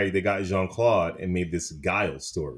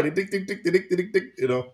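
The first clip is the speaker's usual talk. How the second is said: sung star-power jingle; imitated music jingle; 9 semitones higher, faster than usual.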